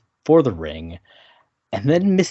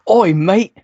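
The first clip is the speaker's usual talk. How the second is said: a cockney-ish "oi, mate", higher and louder; mock English accent; 8 semitones higher, 6 dB louder than usual. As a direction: ham up a bad English accent. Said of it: "English impersonation".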